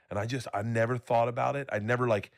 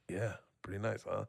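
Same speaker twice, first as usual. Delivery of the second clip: mock casual; 9 dB quieter than usual. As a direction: say it quietly, as if nothing were odd. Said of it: "Just left it in there like".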